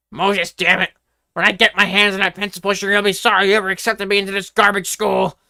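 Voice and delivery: loud angry rant; seething, loud and angry; controlled anger. A narrator's reading. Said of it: "with controlled anger in his voice".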